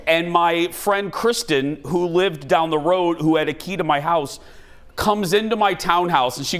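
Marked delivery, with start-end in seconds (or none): none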